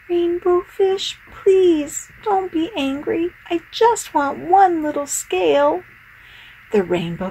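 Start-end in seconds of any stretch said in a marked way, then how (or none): 0.1-5.8 high, pleading little fish. Acted. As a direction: ask gently, high and small.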